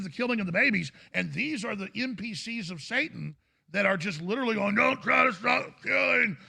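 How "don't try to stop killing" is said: higher, louder slow shout; mock demonic shout. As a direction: yell it like a possessed zombie. Quoting NPCs of Satan.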